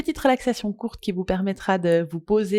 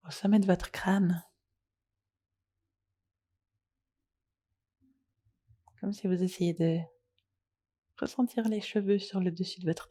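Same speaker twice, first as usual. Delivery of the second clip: soft, unhurried yoga nidra guidance with long pauses; guided meditation; 5 dB quieter than usual. Acted. Leads a guided relaxation.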